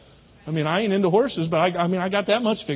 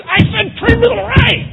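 slow, furious shouted command; angry shout; 12 semitones higher, 8 dB louder than usual. Pretend. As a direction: bellow it, losing all patience. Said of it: "so you get your whip out and your spurs".

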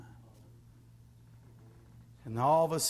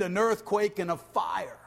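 usual speaking voice

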